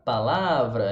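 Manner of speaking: "palavra" with LA stretched out; stress on middle syllable. Shown as a demonstration. Teacher showing Portuguese word stress, LA in "palavra" stressed. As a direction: lean hard on the "la" of "palavra". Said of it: "A tônica é a sílaba mais forte da palavra".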